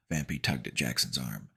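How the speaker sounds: plain reading voice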